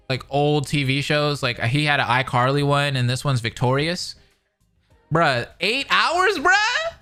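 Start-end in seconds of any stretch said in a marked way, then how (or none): 5.1-6.9 high-pitched, slow disbelief; incredulous. Real, not acted. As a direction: stretch it out, rising into a stunned high question. Shocked at an eight-hour review.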